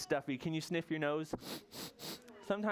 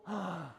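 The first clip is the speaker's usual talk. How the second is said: big audible breath in; demonstrated gasp; 4 semitones higher than usual. Shown as a demonstration. Teacher readies children to listen, demonstrated gasp. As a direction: gulp in air to catch a bubble. Children told to catch a bubble.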